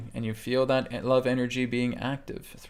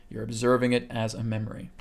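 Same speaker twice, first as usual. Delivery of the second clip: ordinary talk, nothing marked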